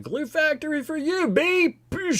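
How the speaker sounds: high, far-off mock horse voice. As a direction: squeal it out like a sassy horse. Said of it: "And he was like".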